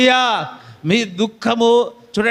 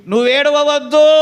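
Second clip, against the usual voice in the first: louder and higher, a firm call not to weep; raised preaching cry; commanding call; 5 semitones higher, 5 dB louder than usual. Acted. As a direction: proclaim it over the crowd like a command. Jesus tells mother not to weep.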